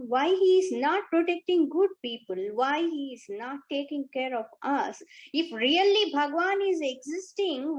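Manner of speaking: complaining doubter. Retold or imitated. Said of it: "asking questions".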